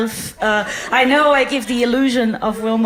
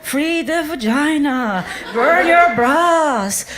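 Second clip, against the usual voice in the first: mock feminist rally; 3 semitones higher, slower than usual. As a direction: ham up a ranting activist. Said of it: "very feminist and like".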